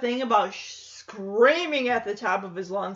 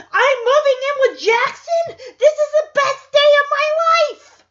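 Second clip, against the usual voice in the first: high, loud mock-ecstatic shout; hammed-up burst of joy at top pitch; imitating Max's wild energy; 14 semitones higher, 8 dB louder than usual. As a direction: shriek it like an overexcited kid. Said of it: "his energy could".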